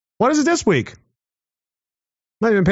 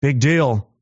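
usual speaking voice

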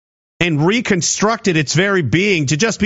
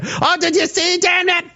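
high, mocking gossipy squeal; mock gossip; 10 semitones higher than usual. Mocking distracted Canadians, squealing gossip about the outfit. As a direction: ham up a starstruck fan gasping over an outfit.

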